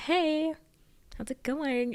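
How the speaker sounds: high-pitched, lightly acted carefree hello; breezy popular girl. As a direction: greet them as if nothing happened. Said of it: "radiant, glowing, and beautiful".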